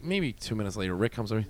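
normal talking voice

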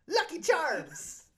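usual speaking voice

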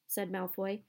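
ordinary delivery, nothing marked

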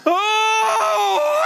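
long, piercingly high whooping scream; imitated thrilled scream. Grandfather imitating granddaughter as they launch over the hill, thrilled scream. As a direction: scream it out like a child on a coaster. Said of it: "She's screaming".

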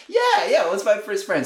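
high-pitched mock-keen agreement; hammed-up enthusiastic yes, starting very high; imitated eager group. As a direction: play gullible followers jumping on board. Everyone eagerly agrees, acted.

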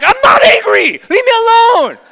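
high, full-force angry shout; yelling back. Retold or imitated. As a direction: re-enact a teen screaming back at mom. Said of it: "And I would yell back".

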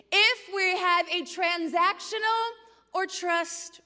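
high, loud, slow proclamation; preaching at full force. Real, not acted. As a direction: drive each word home, stretched out for the crowd.

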